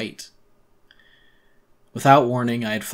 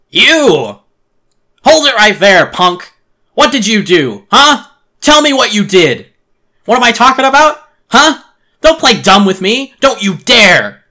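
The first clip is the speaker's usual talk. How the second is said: full-force, high-pitched furious yelling; angry shouting; 12 semitones higher, 19 dB louder than usual. A narrator's reading. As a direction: shout accusingly at a culprit. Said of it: "shouted at me".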